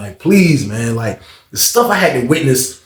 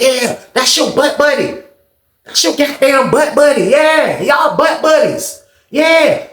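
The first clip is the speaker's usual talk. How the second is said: high, slow jeering shout; retold mocking; 8 semitones higher, slower than usual. The boy mocking Sean about his butt buddy, high shout. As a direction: rub it in with a shout.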